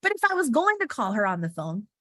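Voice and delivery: ordinary delivery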